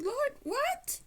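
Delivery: sudden high squeak of disbelief; shocked disbelief. Retold or imitated. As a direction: gasp it out, stunned, pitch jumping way up. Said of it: "and I was surprised".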